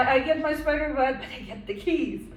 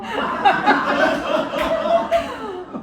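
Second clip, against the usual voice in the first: higher, louder embarrassed laugh; burst of laughter; 9 semitones higher, 6 dB louder than usual. Real, not acted. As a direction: break up laughing. Reacting to embarrassing moment.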